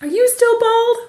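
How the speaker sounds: high, loud imitation of a boy; child calling out. Retelling her son's question from across the field, calling out. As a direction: replay a kid's far-off holler.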